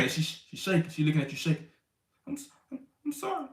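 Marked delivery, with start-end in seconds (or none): 2.3-3.5 high, soft, trembling apology; small frightened voice, much higher and softer; scared wife. Retold or imitated.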